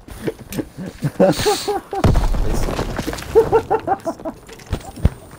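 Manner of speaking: high, wheezy fit of laughter; long, high laugh with little voice; laughing hard. Real, not acted. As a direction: crack up helplessly. Friends joking, cracks up.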